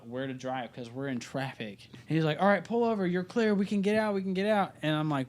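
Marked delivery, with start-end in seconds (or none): 2.4-4.8 higher, urgent call-outs; a hint of a friend shouting directions. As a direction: guide the driver out of traffic. Friend directing driver in traffic.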